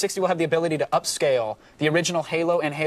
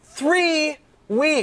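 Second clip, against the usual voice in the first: disdainful; 9 semitones higher than usual. Real, not acted. Repeats complaint with disdain.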